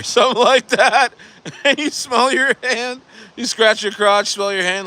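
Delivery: high, laughing through the words. Real, not acted. Storyteller describing a bad smell, high laughing voice. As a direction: crack up as you describe it.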